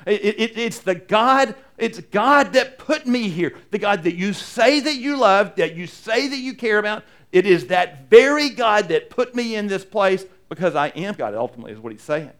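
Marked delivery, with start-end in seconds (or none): 0.1-11.3 rising, louder preaching build; full-force preaching. Acted. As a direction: drive it home from the pulpit. Preaching as Jesus' claim.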